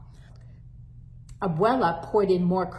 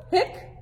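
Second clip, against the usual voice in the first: hiccup; 11 semitones higher than usual. Acted. Hector hiccups.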